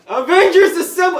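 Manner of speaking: very high, loud rallying shout; battle cry. Retold or imitated. Cap rallying the heroes with a loud shout. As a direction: yell it like a hero's call. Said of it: "he's like".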